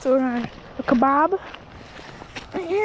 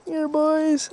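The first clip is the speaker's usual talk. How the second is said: ordinary delivery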